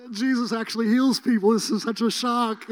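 a preacher crying with joy at a healing; tearful amazement. Retold or imitated. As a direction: blurt it out through happy tears. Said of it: "I'm crying".

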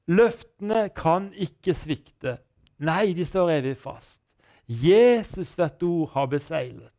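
slow, measured lines with pauses between; hymn recitation. Retold or imitated. Recalling the hymn that carried him through, solemn slow recitation. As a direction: recite it like verse, each phrase drawn out.

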